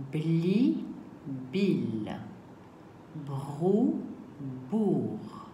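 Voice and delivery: teacher sounding out syllable pairs slowly; slow syllable drill. Shown as a demonstration. Teacher models syllables for pupils at home, slow clear drill. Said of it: "On va passer au mécano des lettres".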